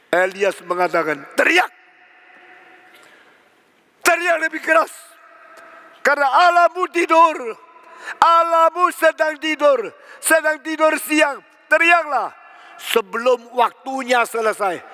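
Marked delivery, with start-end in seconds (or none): 1.4-12.3 high, projected jeering; mocking taunt. Elias mocking the prophets of Baal, high and jeering. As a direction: taunt them in a raised, ringing voice.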